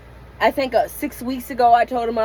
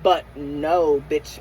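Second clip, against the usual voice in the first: normal talking voice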